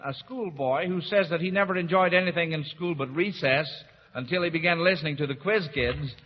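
ordinary talk

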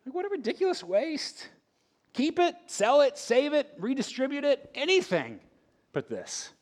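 mock outrage. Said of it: "I'm right with them".

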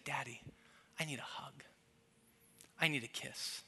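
very soft, hushed request; tender plea. Retold or imitated. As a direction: imitate a hurt child quietly asking. Wronged daughter still asks for affection.

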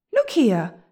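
loud, firm protest. A narrator's reading. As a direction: put your foot down. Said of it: "said William firmly".

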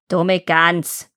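mildly contemptuous insult; scornful witch. A narrator's reading. Witch insults hesitating Gretel, scornful. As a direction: snub her with witchy disdain.

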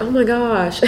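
playful mock Southern accent, laughing. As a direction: tease mom with a hint of Southern accent. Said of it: "we make her sound way Southern".

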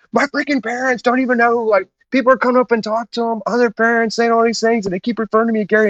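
high-pitched imitation of an embarrassed, irritated friend; embarrassed, pissed. Friend retells Baird griping about his parents, mock embarrassed outrage. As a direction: complain in a high mock-aggrieved voice. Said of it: "He was so embarrassed".

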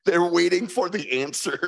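ordinary delivery

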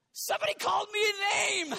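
usual speaking voice

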